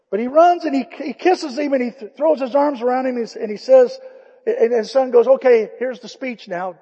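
loud, high, rising excitement; preaching at full force. Acted. Preacher telling the congregation how the father ran, loud and high. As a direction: swell it like the climax of a sermon.

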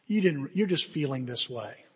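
soft, low reassurance; gentle shepherd. Retold or imitated. Good Shepherd excusing his outburst, gentle reassurance. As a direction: reassure quietly and tenderly. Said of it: "the Good Shepherd reminds me".

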